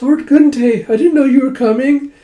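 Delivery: put-on flustered girl suddenly greeting a lord, shrill squeal; flustered freak-out. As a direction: squeal in startled embarrassment. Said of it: "she just freaks out".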